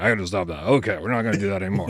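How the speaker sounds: ordinary talk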